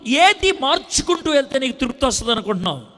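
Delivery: loud, high preacher's challenge; preaching at full force. Acted. Preacher challenging the congregation, loud and high. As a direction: hammer the questions at the congregation.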